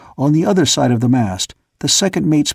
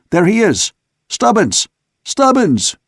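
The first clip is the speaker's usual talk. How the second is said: rising shout, higher each name; 8 semitones higher than usual. A narrator's reading. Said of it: "Suddenly he shouted out".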